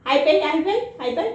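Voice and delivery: high, loud excited coaxing; laughing high pet encouragement; excited pet talk. Real, not acted. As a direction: cheer the dog into a high five. Asking dog for high five.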